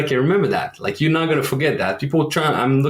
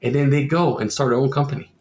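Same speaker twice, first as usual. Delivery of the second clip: usual speaking voice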